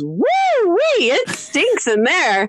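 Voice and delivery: high, drawn-out mock whoop of disgust; grossed-out kid. Kid opening the bathroom door on a stink, grossed out. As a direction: imitate a kid reacting to a stink.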